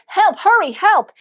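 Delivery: loud, high frantic cries; panicked. Retold or imitated. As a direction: cut in urgently, yelling for help. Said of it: "I'm so panicked".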